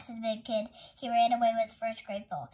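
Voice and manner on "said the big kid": plain reading voice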